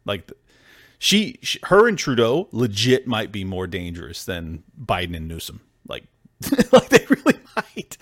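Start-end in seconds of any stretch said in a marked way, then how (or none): 5.9-8.0 high laugh breaking into the words; laughing disbelief. Real, not acted. Host laughing at how dangerous Trudeau might be, high laughing voice. Laughing at his own claim.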